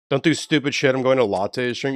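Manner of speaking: normal talking voice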